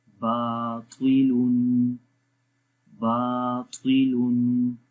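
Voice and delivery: "बातिलुन" slow, with "बा" held long; long baa. Shown as a demonstration. Teacher reads the word for learners, "बातिलुन" with elongated "बा". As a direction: stretch the first syllable of "बातिलुन". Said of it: "लंबा करेंगे".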